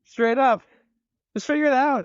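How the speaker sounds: fed up, just tell them to sort it; high, fed-up order to the staff; exasperated order. Retold or imitated.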